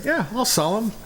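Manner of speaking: lightly acted ready agreement; dog's owner. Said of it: "And the guy said".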